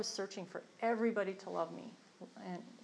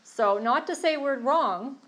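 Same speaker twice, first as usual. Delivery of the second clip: slow, loud, high insistence on not to say; emphatic; 6 semitones higher, 12 dB louder, slower than usual. Real, not acted. Sharing in a meeting, she insists loudly. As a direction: lean hard into not to say we're wrong, slowly.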